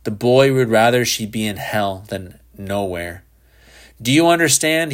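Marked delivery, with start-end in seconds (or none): 4.0-4.9 much higher, pressing question; exasperated insistence. A narrator's reading. Father insisting to disbelieving son, exasperated. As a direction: press the point, pitched up.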